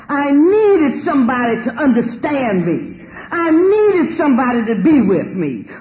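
high, loud and slow, voice breaking into tears; reliving the loneliness, crying out. Real, not acted. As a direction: plead through tears for company.

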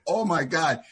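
normal talking voice

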